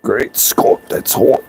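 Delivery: breathless pained whisper; burnt fingertips. Real, not acted. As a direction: gasp it out after the burn. Burns fingers on hot metal.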